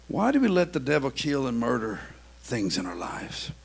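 ordinary talk, nothing marked

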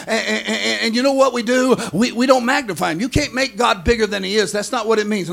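normal talking voice

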